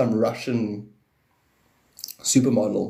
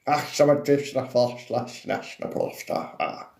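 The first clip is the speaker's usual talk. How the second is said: made-up gibberish language; 2 semitones higher, slower than usual. Pretend. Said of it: "And you're like".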